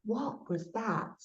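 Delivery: soft, slow, awed question; hushed wonder. Retold or imitated. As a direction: ask it slowly under your breath. Wonder after mum's glow.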